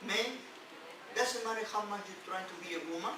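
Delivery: usual speaking voice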